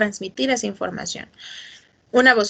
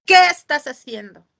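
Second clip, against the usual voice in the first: louder, pointed question; a louder demo of the question; louder take; 2 semitones higher, 6 dB louder than usual. Shown as a demonstration. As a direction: ask it with more force. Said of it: "No es lo mismo decir".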